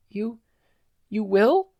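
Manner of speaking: put-on hopeful little girl. Eri hearing she'll be rescued, timid hope. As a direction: dare to hope.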